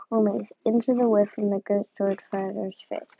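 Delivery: plain reading voice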